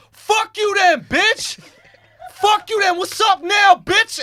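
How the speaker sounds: high shouted insult; retold shout. Alex yelling at the bouncer from behind, shouted. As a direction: yell it at the bouncer. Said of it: "Alex just goes".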